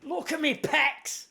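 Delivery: mock Northern English brag, high and slow; a cocky Northern English bloke showing off, slow and high; northern accent. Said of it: "he's gone really northern".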